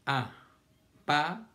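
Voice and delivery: "Apaga" in three slow beats, a... pa... ga; syllable by syllable. Shown as a demonstration. Teacher showing the class the middle syllable, syllable by syllable. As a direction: separate "pa" from its neighbours in "Apaga". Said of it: "Miren la sílaba del medio".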